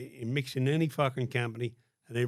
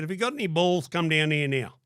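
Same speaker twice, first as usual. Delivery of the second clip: taunting challenge; 4 semitones higher, 6 dB louder than usual. Retold or imitated. Retold taunt, calling enemy out.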